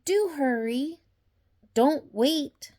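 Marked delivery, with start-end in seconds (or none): none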